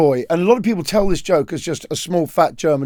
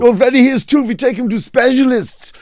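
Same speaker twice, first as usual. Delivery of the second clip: German parent; 6 semitones higher, 4 dB louder than usual. Acted. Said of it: "He goes".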